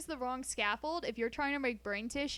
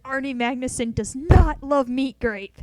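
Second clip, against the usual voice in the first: loud declaration; 16 dB louder than usual. Real, not acted. Declaring a stance to chat.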